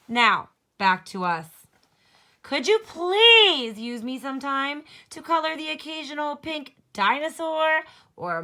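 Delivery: loud, sassy complaining crayon; sassy pink crayon. Acted. Teacher voicing pink crayon scolding Duncan, sassy and loud.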